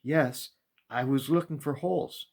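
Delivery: put-on high eager young boy; boy's voice. A narrator's reading. Boy explaining to the maker, high eager young voice.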